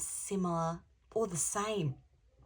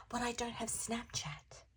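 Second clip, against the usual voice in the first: whisper; 6 semitones higher, 7 dB quieter than usual. Retold or imitated. Ali's punchline in Notebook parody.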